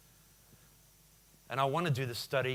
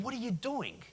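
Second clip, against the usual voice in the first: much higher, startled and annoyed cry; indignant outburst; 10 semitones higher than usual. Retold or imitated. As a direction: yelp at the guy who just walked into you. Bumped by man staring at phone.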